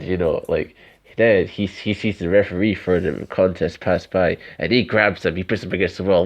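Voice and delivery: normal talking voice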